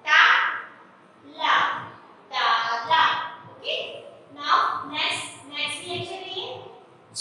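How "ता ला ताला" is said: louder sounding out, then the whole word ताला said with a low fall; blending syllables into ताला for the class. Shown as a demonstration. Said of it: "अब एक साथ हम क्या बोलेंगे इसको?".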